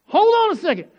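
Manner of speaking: high, loud, startled; can't believe the check in his hand; excited disbelief. Retold or imitated. Pastor staring at a surprise check, excited and high. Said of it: "I'm like".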